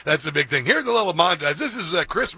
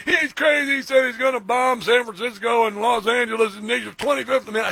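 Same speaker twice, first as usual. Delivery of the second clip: mocking Democrats; 6 semitones higher than usual. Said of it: "And the Democrats are like".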